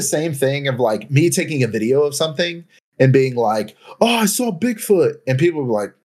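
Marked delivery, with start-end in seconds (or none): 4.0-5.2 high, excited claim; mock excited. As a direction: gush like you saw Bigfoot. Mock claim of seeing Bigfoot.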